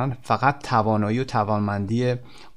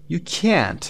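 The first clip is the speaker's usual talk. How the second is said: can't higher and drawn out; stress on can't; 5 semitones higher than usual. Shown as a demonstration. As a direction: punch and stretch can't. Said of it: "به این صورت". (can't کشیده و با تأکید)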